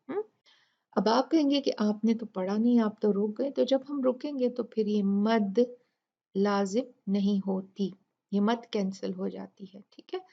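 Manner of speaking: ordinary talk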